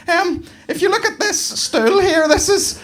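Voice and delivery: high nervous tour-guide voice, slowing at first; flustered staffer bluffing through the pitch; nervous guide selling a fake exhibit to tourists; panicked museum guide. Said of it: "one panicked member of staff just kinda going".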